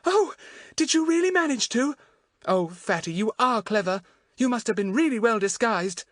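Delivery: high, delighted squeal of admiration; shriek of delight. A narrator's reading. Daisy admiring Fatty's clever disguise, delighted shriek. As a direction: gush in a high, thrilled voice. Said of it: "Daisy gave a shriek of delight".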